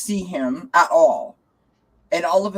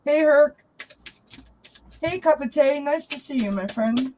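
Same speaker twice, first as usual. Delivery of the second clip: bright greeting; 9 semitones higher than usual. Real, not acted. Greeting viewers in chat.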